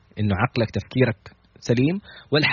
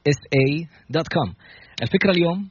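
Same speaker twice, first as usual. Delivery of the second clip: normal talking voice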